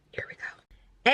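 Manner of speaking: whispered lead-in to the reveal. Real, not acted. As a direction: hush it before the reveal. Before facial reveal.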